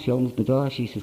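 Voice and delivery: usual speaking voice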